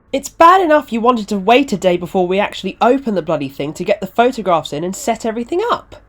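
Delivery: high-pitched, aggrieved rant; annoyed complaint. Acted. Organiser complaining to Ronnie about the delay, annoyed.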